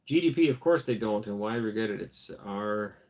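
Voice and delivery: ordinary delivery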